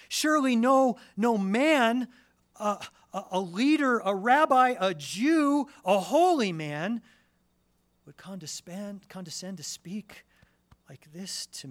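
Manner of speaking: high, loud, incredulous exclamations; astonished disbelief. Pretend. Preacher voicing the Samaritan woman's astonishment, high and loud. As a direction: stammer in amazement that he'd speak to her.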